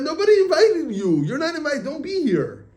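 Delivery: high-pitched outburst, starting loud; storyteller's exasperated high rebuff; indignant dismissal. Retold or imitated. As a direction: act out sending an intruder away. Retold rebuke of the Yetzer Hara.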